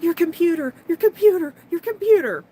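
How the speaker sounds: high, loud imitation of a panicked brother; hyperventilating panic. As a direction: cry it out in mock hyperventilating alarm.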